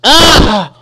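sharp, high, loud cry of shock; sudden high yelp; startled yell. Real, not acted. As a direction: yelp as you get hit. Player dies a second time.

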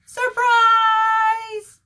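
high, loud, drawn-out yell; surprise shout. Acted. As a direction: burst out as a party crowd. Fish shout at surprise party.

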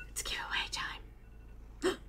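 slow conspiratorial whisper. Pretend. Teasing viewers with a giveaway, slow whisper. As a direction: hush it like a secret.

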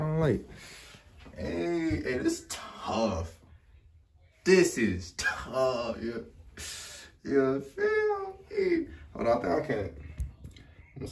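muffled through knit fabric; speaking through ski mask. Real, not acted. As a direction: mouth covered by balaclava. Seen 0: Knit ski mask pulled over mouth in mirror; references show bare face.

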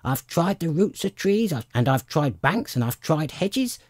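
high, complaining bird voice; harried mother bird grumbling; fretful pigeon. A narrator's reading.

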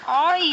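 sharp high yelp of shock; loud yelp. Real, not acted. Gamer startled by something in game, loud yelp. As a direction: cry out suddenly, loud and high. Sudden pain or shock cry.

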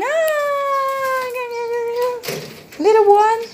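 high cooing puppy talk. Real, not acted. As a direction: coo over the little one.

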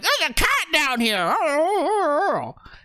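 high, loud creature chatter; greedy monsters spotting a meal; excited fish folk. Acted.

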